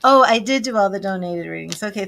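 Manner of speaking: ordinary talk, nothing marked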